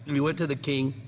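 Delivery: ordinary talk, nothing marked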